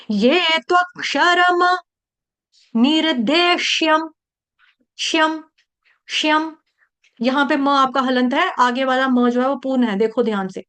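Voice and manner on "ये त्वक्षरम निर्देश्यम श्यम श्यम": ये त्वक्षरम निर्देश्यम recited slowly and high, श्यम clipped; slow recitation. Shown as a demonstration. Teacher recites the phrase and drills श्यम. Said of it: "ठीक है".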